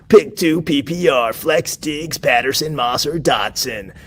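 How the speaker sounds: a lightly done epic trailer announcer, drawn out at the end; movie trailer voice. Shown as a demonstration. Host to his viewers, hamming up a mock trailer announcer. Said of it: "I'll just do the rest of the questions like this".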